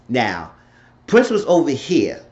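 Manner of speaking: ordinary talk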